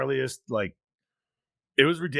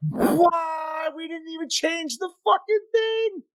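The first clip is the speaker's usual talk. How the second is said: shocked outburst; 14 semitones higher, slower than usual. Real, not acted. Hosts notice uncaught error.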